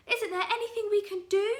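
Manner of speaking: high, loud desperate plea; desperate Mrs Twit. Acted. Wife begging for a cure, high desperate plea. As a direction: plead high and frantic. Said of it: "cried Missus Twit".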